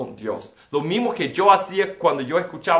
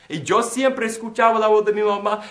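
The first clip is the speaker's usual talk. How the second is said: normal talking voice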